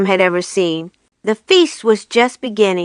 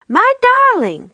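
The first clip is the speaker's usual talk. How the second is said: a high, joyful cry; cried out. A narrator's reading. Missus Fox crying out joyfully as her husband returns. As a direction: cry out with delight at the reunion. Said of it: "cried Missus Fox".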